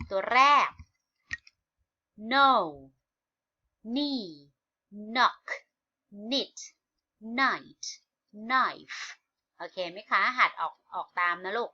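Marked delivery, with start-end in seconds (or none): 2.3-9.0 one word at a time, each starting on N, from "no" to "knife"; silent K drill. Shown as a demonstration. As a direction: model "knock", "knit", "knife" for repeating, pausing after each. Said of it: "เราลองมาอ่านพร้อมกัน". (อ่านนำทีละคำ เว้นจังหวะให้ออกเสียงตาม ไม่ออกเสียง K)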